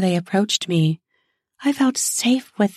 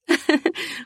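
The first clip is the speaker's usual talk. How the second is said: light laugh; 8 semitones higher than usual. Acted. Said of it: "I laughed".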